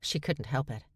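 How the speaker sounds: usual speaking voice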